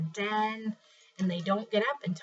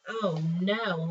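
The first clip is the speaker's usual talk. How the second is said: usual speaking voice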